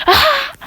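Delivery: high, loud scream of shock; mock scream. Kids shown a dead body, mock horrified scream.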